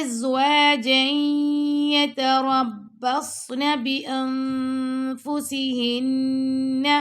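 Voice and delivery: slow chanted recitation; Quran recitation. Shown as a demonstration. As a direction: draw each word out in full recitation. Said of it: "teacher recites Quran verse".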